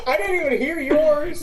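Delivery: high, loud laughing talk; cracking up while speaking; real laughter. Real, not acted. Laughing at friend's pain.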